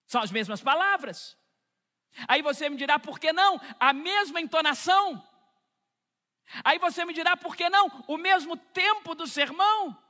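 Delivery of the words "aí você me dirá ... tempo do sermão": each question higher and louder than the last; rising preaching fervor. Acted. Preacher to congregation, climbing fervor. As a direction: build the crowd's objection to a peak.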